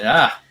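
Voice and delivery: a loud relieved ahh; blissful mock exhale; mock moan of relief. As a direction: let out a hammed-up contented groan. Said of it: "he's looking and going".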